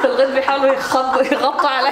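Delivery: amused echo of a fluffed line; laughing mimicry. Teasing her co-actor's slip, laughing mimicry. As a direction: repeat the slip through giggles.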